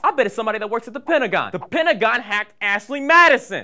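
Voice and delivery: high, loud, hammed-up accusation; mock conspiracy excitement.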